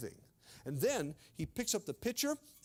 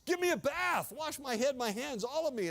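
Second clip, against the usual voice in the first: eager plea; 6 semitones higher, 4 dB louder than usual. Acted. Peter eagerly begging for full wash.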